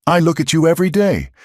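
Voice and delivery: high, fast, desperate plea; pleading outcry. A narrator's reading. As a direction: insist through pain. Silas pleading with Elara.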